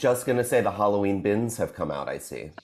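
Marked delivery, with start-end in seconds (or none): 2.1-2.5 soft breathy whisper. Real, not acted. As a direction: whisper it under your breath. Whispered aside.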